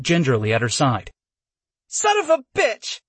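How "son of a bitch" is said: high yelp through gritted teeth; pained yelp. A narrator's reading. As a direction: yelp it out in sudden pain. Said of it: "she yelped through gritted teeth".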